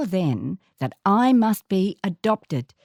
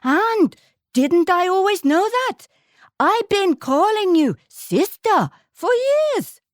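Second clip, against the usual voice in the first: high, louder, delighted friend's voice; African friend; 11 semitones higher, 4 dB louder than usual. Retold or imitated. African friend greeting the news, high delighted voice. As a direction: beam it as an old truth. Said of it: "my African friend who said".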